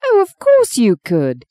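high, emphatic indignant insistence; insist, pitched right up. A narrator's reading. Woman insisting her friend could win a beauty, indignant. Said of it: "she said indignantly".

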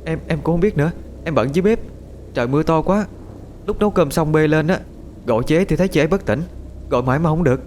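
a fretful younger sister stammering out what happened; flustered young aunt. Acted.

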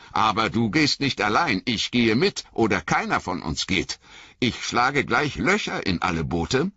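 high, hurt, shivering reproach; a wet hobbit stung by his master; Sam, cold and soaked, reproaching Frodo in a high voice. Acted.

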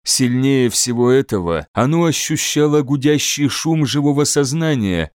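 ordinary delivery, nothing marked

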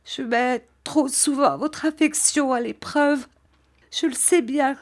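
high, tearful confession; still crying. A narrator's reading. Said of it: "Et elle pleurait toujours".

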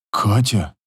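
lightly hushed, voiced shock; stunned whisper. A narrator's reading. Father stunned by daughter's curse, understated stunned whisper. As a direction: say the name low, a touch stunned. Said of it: "поражённо шепчет бывший".

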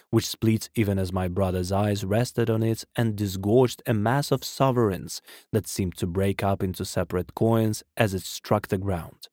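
ordinary delivery, nothing marked

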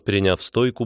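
plain reading voice

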